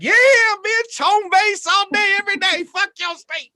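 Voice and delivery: high, loud taunting yell, slowing on home base; gloating shout. Retold or imitated. Comic taunting a state's cops in a triumphant high yell. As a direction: crow it like you just won at hide and seek.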